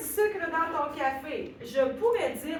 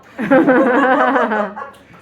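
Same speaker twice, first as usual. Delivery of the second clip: a big burst of laughter, much louder; 19 dB louder than usual. Real, not acted. Teacher laughs at her messy board writing, loud laughter. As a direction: crack up laughing at yourself.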